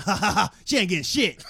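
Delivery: loud gloating laugh; cackling gloat; mocking laugh. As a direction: laugh it out smugly.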